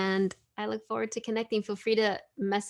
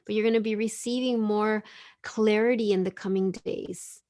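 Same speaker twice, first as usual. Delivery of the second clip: ordinary delivery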